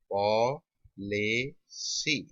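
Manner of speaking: "Policy" very slow, three separate syllables; slow sounding-out. Shown as a demonstration. Teacher writing the example for students, slow sounding-out.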